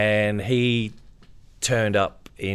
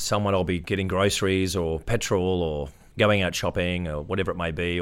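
usual speaking voice

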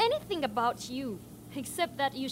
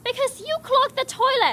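higher, louder exasperated retort; annoyed shout; 10 semitones higher, 8 dB louder than usual. Acted. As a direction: snap back at the roommate who clogged it.

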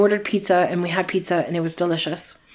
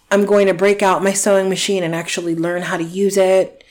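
ordinary talk, nothing marked

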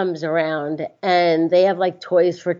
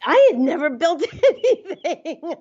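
laughing admission; 10 semitones higher than usual. Real, not acted. Laughs admitting inexperience.

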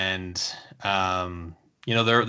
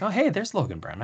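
higher-pitched imitated "oh, hey" reaction; mock pleasant surprise; 8 semitones higher than usual. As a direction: act out suddenly noticing someone, lightly. Acted casual noticing of a player.

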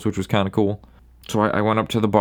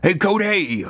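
put-on high hollered drunken hey; drunk call out; 10 semitones higher, slower than usual. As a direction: call across the bar, tipsy and high. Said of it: "he's like".

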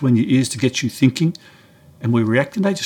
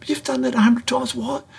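much higher, disbelieving You've done that; incredulous; 13 semitones higher than usual. Retold or imitated. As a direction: pitch way up in disbelief. Disbelief at friend's sailing mishap.

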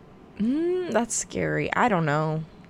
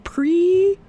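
word stretched by a yawn; 13 semitones higher, 4 dB louder than usual. Real, not acted. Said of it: "sorry, I'm yawning for some reason".

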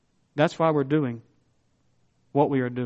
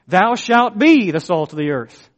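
mock command; 4 semitones higher, 8 dB louder, slower than usual. Shown as a demonstration. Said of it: "it's not in the form of a command".

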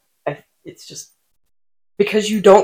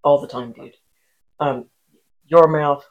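usual speaking voice

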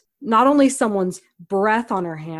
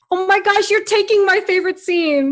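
excited squeal; 10 semitones higher, 4 dB louder than usual. Real, not acted. Host excited about favorite scene.

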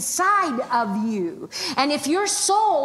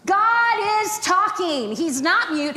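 preaching at full force; 7 semitones higher than usual. Acted. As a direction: press faith on the crowd like a revival preacher.

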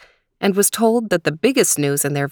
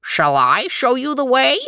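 higher-pitched frog voice, swooping up; 7 semitones higher than usual. A narrator's reading. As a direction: offer help as a frog, rising to a high finish. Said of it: "The frog said".

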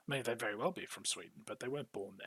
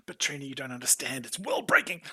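mock protest; 2 semitones higher, 8 dB louder than usual. Playing his protest to the wife.

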